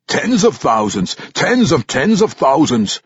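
loud, high gruff dwarf scoff; gruff old dwarf warrior. Acted. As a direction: scoff like a crusty old veteran. Old warrior Ragged Dane voiced.

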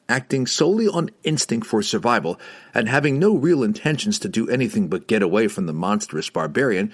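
ordinary delivery

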